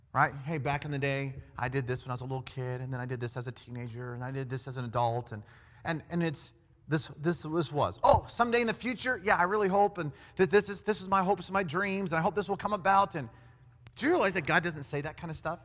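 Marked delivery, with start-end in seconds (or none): 0.4-5.3 quick, low rattled-off life story; mock reminiscing. Preacher mimics how we talk about our past, mock reminiscing. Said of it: "that's how we talk". 8.0-13.2 higher, eager gush of hopes; mock wishful. Preacher mimics people hoping for someday, mock wishful gushing.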